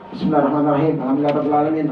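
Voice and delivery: ordinary delivery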